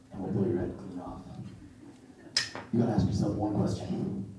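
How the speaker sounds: low, hushed Dirty Harry growl-free menace; Dirty Harry impression. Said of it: "do some impressions".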